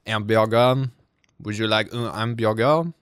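mock-French accent. Shown as a demonstration. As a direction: put on a French accent, dropping the h. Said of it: "the way that I get into French".

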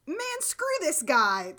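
a hammed-up fed-up outburst; imitating her irritation; mock annoyed. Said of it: "love the contrast of her being like".

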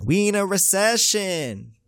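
high, drawn-out exclamation; slow, high-pitched comic outcry; exclaimed repeat. Acted. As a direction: stretch it out way up high. Repeats the line, hammed up higher.

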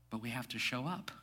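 usual speaking voice

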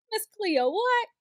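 mock-shocked disbelief; incredulous what. Real, not acted. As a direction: pitch shoots way up on what. Reacting to the fake accent.

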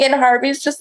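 ordinary talk, nothing marked

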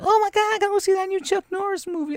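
hammed-up fangirl squeal; gushing wife. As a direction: play a swooning wife who can't wait to see the movie. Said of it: "the wives are like".

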